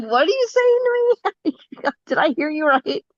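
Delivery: can't believe the gift she's hearing; stunned disbelief. Retold or imitated. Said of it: "I was just like".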